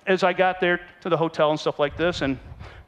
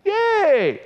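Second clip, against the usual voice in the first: mock cheer; 6 dB louder than usual. Cheering at love letters.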